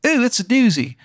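high Maryland hon voice; Maryland accent. Acted. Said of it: "He goes".

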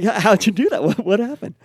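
loud, high, amazed group outcry; excited disbelief. Retold or imitated. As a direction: blurt it out like the whole group can't believe it.

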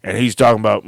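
ordinary talk, nothing marked

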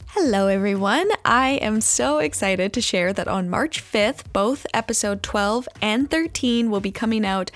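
upbeat podcast host read; announcement read. Acted. Host announcing the season finale to listeners, upbeat read.

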